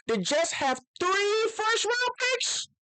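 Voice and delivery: high, slow, drawn-out disbelief; high astonished question; surprised question. Real, not acted. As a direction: stretch it out, amazed at the number. Surprised realizing the Jets' picks.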